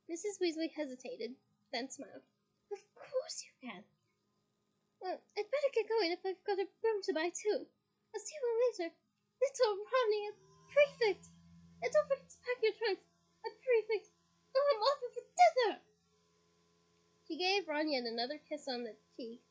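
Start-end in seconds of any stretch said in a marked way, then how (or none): 2.7-3.8 tender mum. Acted. Mum agrees. 5.0-15.8 high, loud dithering mother; flustered mum. Acted. Mother fussing as she rushes off. Mrs Weasley flustered leaving.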